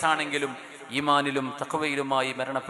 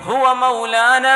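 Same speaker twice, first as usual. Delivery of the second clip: intoned Arabic; 11 semitones higher, 12 dB louder, much slower than usual. Acted.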